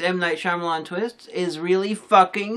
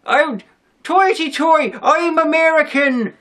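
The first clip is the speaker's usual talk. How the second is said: put-on high, slow, hammy American accent; bad American accent; 9 semitones higher, 5 dB louder, slower than usual. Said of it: "With a really bad American accent".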